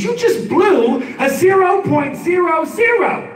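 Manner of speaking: high, exasperated cop outburst; drawn-out, high-pitched comic indignation; upset cop. Retold or imitated. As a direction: imitate an upset officer, pitch way up. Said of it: "He's upset".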